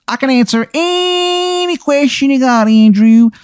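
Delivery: high, drawn-out Baltimore accent put on thick; slow, high-pitched cartoon Baltimore accent; terrible Baltimore accent. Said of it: "you can hear his terrible accent".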